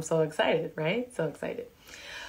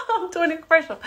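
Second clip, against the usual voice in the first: fast, laughing self-tease; 9 semitones higher, 6 dB louder, much faster than usual. Real, not acted. Host laughing that she's doing an ad. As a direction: catch yourself mid-pitch and laugh.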